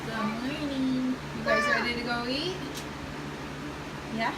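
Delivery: high, singsong morning greeting. Real, not acted. Mother greeting her child on waking, sweetly.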